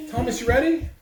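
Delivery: ordinary talk, nothing marked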